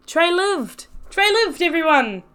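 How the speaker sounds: high, loud joyful announcement; overjoyed he made it; excited cheer. Acted. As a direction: shout the good news to the room. Excited reunion, announcing survivor.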